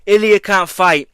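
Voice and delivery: a hint of a hurried matchmaker calling fighters; mock UFC matchmaker. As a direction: play the boss ringing round for a replacement. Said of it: "They're like".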